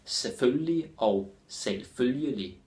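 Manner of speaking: first "Selvfølgelig" shortened, second "selvfølgelig" slow and full; two different pronunciations. Shown as a demonstration. Teacher showing that people pronounce it differently, reduced then full. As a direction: contrast a reduced "Selvfølgelig" with a drawn-out, fully sounded "selvfølgelig". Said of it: "people pronounce it differently".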